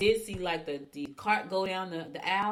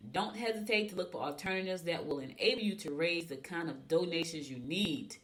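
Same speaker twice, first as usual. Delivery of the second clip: usual speaking voice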